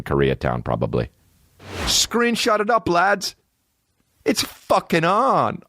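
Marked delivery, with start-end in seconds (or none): 1.9-5.6 much higher, hammed-up hype; rally the lads like a streamer; hyped mock call.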